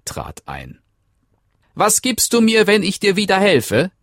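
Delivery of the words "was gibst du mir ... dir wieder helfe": little man. Acted. Männlein fragt erneut.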